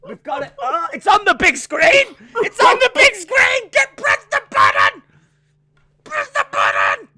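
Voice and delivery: high, loud, shrieking panic, building; hammed-up hysterical yelling; mock panic. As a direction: act out a frantic stagehand meltdown. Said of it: "What I just reenacted there".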